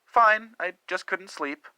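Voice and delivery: husband caught at the drawer answering his wife, shaky and guilty. Acted. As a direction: caught out, try to sound fine. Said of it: "my voice was shaky".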